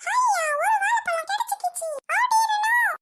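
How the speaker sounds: very high, squeaky monkey voice; monkey gloating over a stolen banana, very high and excited. Acted. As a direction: chirp it out like a cheeky monkey. Monkey character grabs banana.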